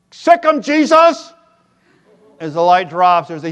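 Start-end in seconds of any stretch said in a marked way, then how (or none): 0.1-1.3 high, loud parrot squawk; parrot shouting command. Acted. Parrot commanding the Doberman, high and loud. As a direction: shriek the command to the dog. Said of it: "The parrot goes".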